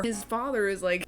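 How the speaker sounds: ordinary talk, nothing marked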